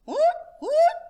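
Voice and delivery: very high, loud bird call; the pheasant waking up; pheasant hoot. Acted. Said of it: "said the pheasant".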